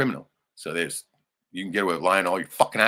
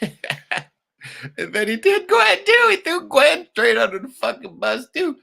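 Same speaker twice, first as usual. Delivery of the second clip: much higher, slow, outraged disbelief; incredulous; 10 dB louder, slower than usual. Real, not acted. Venting in disbelief at the brother's betrayal. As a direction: stretch it out in shocked disbelief.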